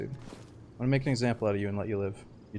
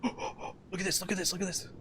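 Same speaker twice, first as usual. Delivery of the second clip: excited gasp; 8 semitones higher, 4 dB quieter than usual. Real, not acted. Watching a sniper fight unfold.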